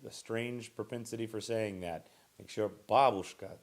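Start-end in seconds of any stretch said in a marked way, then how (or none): 2.9-3.6 babushka, first syllable BA loud and stressed; teacher stresses the first syllable of babushka; correct stress. Shown as a demonstration. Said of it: "the emphasis is on the first syllable".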